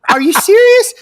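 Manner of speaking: high-pitched disbelieving question; astonished, pitch jumping way up; incredulous. Retold or imitated. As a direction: question it as if you can't believe it. Incredulous reply in retold story.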